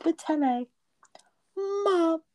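high squeaky British voice; mock British accent. Trying British words in a high mock British accent. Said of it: "how do they say mom in England".

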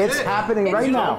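high, loud insistence; shouting over others. Real, not acted. Host pointing at the live quarrel, shouting. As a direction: call it out above the argument.

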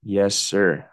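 ordinary talk

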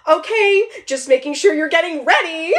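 lightly voiced peppy mom; a hint of a cheerful mom. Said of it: "And Carrie said".

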